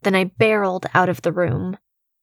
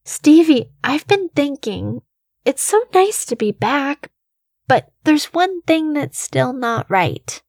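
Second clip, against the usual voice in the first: put-on higher, sing-song man-child voice; Stephen, eager; 7 semitones higher than usual. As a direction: play a deranged man who thinks he's a boy, eager and wheedling. Stephen's voice in the story.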